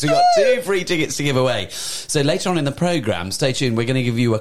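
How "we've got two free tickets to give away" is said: higher burst, loud at the start; hammed-up excitement. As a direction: announce it like thrilling news just remembered. Repeats giveaway news with mock excitement.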